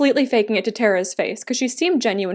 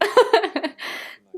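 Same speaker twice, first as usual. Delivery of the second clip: high real laugh; amused laugh; 9 semitones higher than usual. Real, not acted. Viewer tickled by the cute characters, amused laugh.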